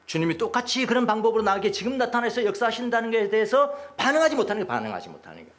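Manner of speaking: usual speaking voice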